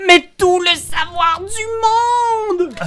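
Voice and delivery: cri aigu et lent, plein d'envie; shout. Acted. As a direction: hurle ton désir de savoir. Joueur crie son envie du musée. (cri aigu et lent)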